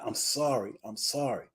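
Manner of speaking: hushed, low imitation of remorse; retold tearful apology. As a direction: quietly voice a tearful man's apology. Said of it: "As he just looked in my eye".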